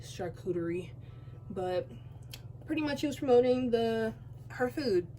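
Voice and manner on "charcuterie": "charcuterie" said with a coochie-like middle, quietly; soft "charcuterie" with a coochie sound in the middle; coochie pronunciation. Shown as a demonstration. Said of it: "I'm saying coochie when I say it".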